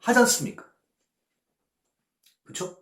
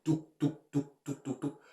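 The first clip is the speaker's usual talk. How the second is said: dripping sound; 9 dB quieter than usual. Retold or imitated. Imitating dripping sweat.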